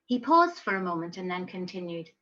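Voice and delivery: usual speaking voice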